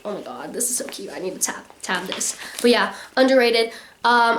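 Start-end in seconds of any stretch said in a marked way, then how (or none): 0.0-2.4 hushed gush. Retold or imitated. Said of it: "I was like".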